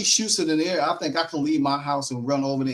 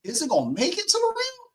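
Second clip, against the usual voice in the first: high, squeaky voice of doubt, much higher; imitating her worried voice. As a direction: ask it in a high, anxious squeak. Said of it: "I'll be like".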